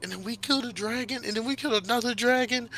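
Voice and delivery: high, breathless run of boasts; excited blabbering. Acted. Midas telling parents of his kills, blabbering excitedly. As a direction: tumble out each deed in a high voice. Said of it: "blabbering on about".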